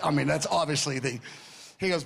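ordinary talk

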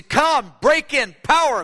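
high, loud urgent plea; urgent impassioned call; urgent cry. Retold or imitated. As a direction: cry out for power to break in. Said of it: "cry to come".

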